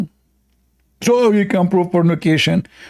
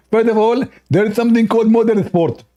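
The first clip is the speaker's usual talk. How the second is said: higher, fast mock lecturer; Zakir Naik; 6 semitones higher, faster than usual. Mocking the opponent's debate answer, higher and rushed. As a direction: rattle it off in a lightly voiced imitation.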